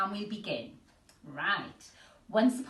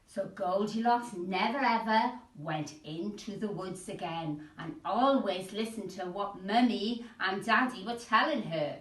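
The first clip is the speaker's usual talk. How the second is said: ordinary delivery, nothing marked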